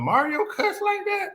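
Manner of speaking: high, shocked mother's voice; shocked mom. Retold or imitated. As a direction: play the scandalised mother.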